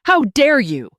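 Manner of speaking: loud, high furious scream. A narrator's reading. Daughter screaming at her mother on the phone, furious. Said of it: "she screamed into the phone".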